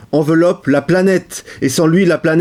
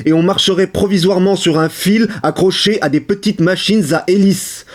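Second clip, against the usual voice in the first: ordinary delivery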